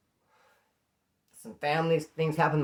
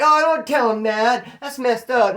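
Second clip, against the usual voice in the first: mock protest; 7 semitones higher, 8 dB louder, slower than usual. Mocking the women's protest.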